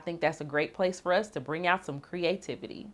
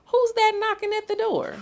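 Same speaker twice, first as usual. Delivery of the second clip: high character voice; 8 dB louder than usual. Acted.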